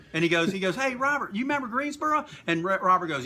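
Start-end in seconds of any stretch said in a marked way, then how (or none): 0.8-2.3 much higher-pitched mock holler; imitating calling over. Wrestler asks his partner about old heckler, imitated call.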